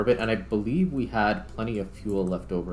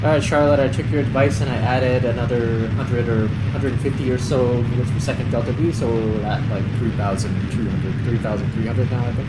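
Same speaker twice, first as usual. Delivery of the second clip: louder, raised voice reporting to the AI; loud call to assistant; 8 dB louder than usual. Real, not acted. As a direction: project it so Charlotte catches every word.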